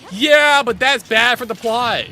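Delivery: loud, high mock protest; hammed-up yell of an excuse; mock outburst. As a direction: burst out in exaggerated objection, imitating a character. Mock reply as the character.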